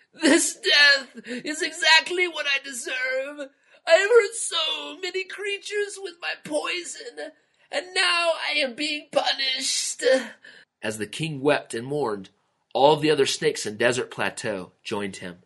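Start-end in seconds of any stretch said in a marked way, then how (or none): 0.1-10.0 very high wailing sob, slow; lament. A narrator's reading. King laments his sister's death, high wailing sob. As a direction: cry out in guilty grief. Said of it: "he burst out with lament".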